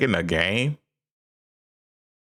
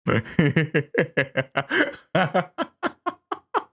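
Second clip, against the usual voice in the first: high creepy laugh; 11 semitones higher than usual. Acted. Laugh clip in stream.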